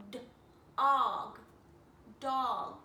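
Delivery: d, og, then dog; blending sounds. Shown as a demonstration. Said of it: "My mouth is making the shape".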